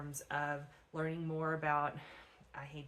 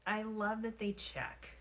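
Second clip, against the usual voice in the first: ordinary talk, nothing marked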